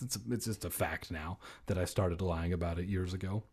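ordinary talk

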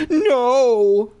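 high, loud cry of horror; sudden horrified shout. Acted. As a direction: scream it out in terror. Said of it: "I uttered a horrified cry".

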